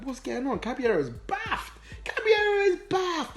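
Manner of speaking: high, drawn-out incredulous voice; mock bewildered outcry, pitch soaring; acted bafflement. Voicing bewildered bench, tells of baffled keeper.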